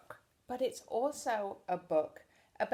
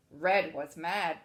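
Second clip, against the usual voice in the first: usual speaking voice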